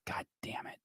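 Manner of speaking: muttered under the breath; whispered curse. Real, not acted. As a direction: whisper it to yourself in frustration. Frustrated at missed comments.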